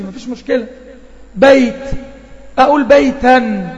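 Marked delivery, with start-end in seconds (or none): 2.6-3.7 بيتًا said slowly and loud, the final -an clearly nasal; teacher sounding out بيتًا; demonstrating tanween. Shown as a demonstration. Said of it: "أو تنوين في الآخر أهو".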